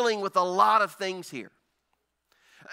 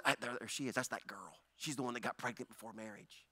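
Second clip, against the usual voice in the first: softer, lower murmur of gossip; lower, softer villagers gossiping about the girl; gossiping hush; 11 semitones lower, 16 dB quieter than usual. Retold or imitated. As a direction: point her out under your breath. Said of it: "And people are gonna say".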